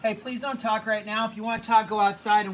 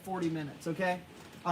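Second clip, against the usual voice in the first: ordinary delivery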